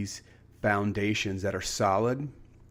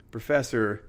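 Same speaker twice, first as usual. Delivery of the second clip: usual speaking voice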